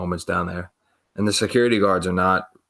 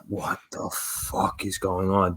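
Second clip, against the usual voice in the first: slow, hushed, drawn-out question; stunned disbelief; 7 dB quieter, slower than usual. Retold or imitated. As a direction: stretch each word quietly in acted shock. Stunned inner reaction to home care.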